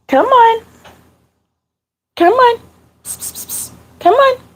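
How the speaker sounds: high-pitched coaxing call to a pet; short, high calls with hushed pssts between; calling a cat. Real, not acted. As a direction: coax a kitty over in a high little voice. Calling her crying kitty in.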